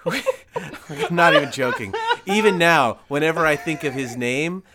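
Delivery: loud, high, through laughter; laughing insistence. Real, not acted. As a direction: insist it's true while cracking up. Breaking up over own mix-up.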